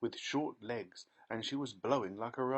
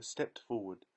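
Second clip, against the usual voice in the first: ordinary delivery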